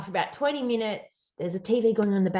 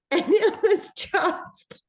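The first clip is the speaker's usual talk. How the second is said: laughing; 6 semitones higher, 4 dB louder, much slower than usual. Real, not acted. Laughing recalling the call.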